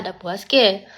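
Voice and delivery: usual speaking voice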